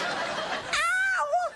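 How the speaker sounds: shrill falsetto "ow" shout; high falsetto whoop. Pretend. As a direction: yelp it way up high. Whooping after a joke.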